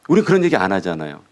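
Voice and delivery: normal talking voice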